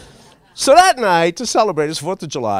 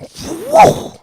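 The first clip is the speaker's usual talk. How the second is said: startled whoa; 7 dB louder than usual. Retold or imitated. Flames shoot up.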